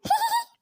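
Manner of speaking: high triumphant laugh; boastful giggle; gleeful laugh. Acted. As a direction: laugh in proud glee. Proud of fast payasam.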